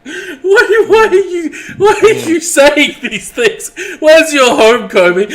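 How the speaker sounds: high, loud incredulous wail; mock outrage. Player reacting to questions about Kobe's home, mock-appalled. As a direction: protest it loudly, pitched right up.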